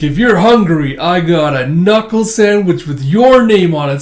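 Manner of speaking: heroic taunt. Retold or imitated. Abraham challenges the mud monster, taunting loudly. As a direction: throw out the one-liner at full voice.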